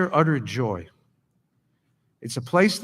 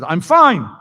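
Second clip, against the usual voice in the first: curt refusal; 6 semitones higher, 10 dB louder than usual. Retold or imitated. Old man curtly refusing help.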